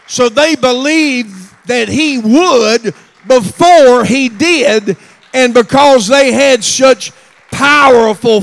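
loud, high preaching that swells to a shout; building preaching. Acted. Preacher urging the church to believe in miracles, rising shouted preaching.

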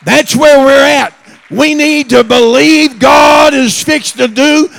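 loud, high preaching that swells to a shout; building preaching. Acted. Preacher urging the church to believe in miracles, rising shouted preaching.

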